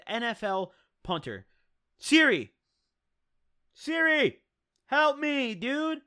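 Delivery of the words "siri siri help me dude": calling Siri. Real, not acted. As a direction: shout the wake word up high, pleading. Calling out to phone assistant.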